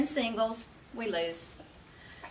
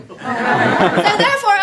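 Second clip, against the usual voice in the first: laughing; 9 dB louder, slower than usual. Real, not acted. Laughing conclusion.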